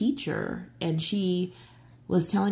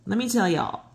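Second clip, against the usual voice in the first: usual speaking voice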